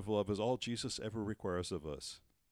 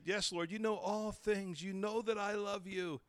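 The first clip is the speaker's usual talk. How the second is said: earnest protest; 9 semitones higher than usual. Retold or imitated. Peter, hurt, answering Jesus's third question, earnest protest. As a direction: insist on it, pitched up and stretched out.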